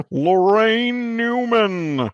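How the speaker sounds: high, drawn-out show announcer. Pretend. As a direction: stretch the name out like a TV announcer. Mimicking the TV show's announcer.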